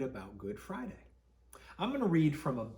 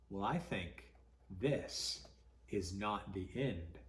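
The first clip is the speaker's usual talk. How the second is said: ordinary delivery, nothing marked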